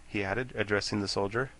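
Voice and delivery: plain reading voice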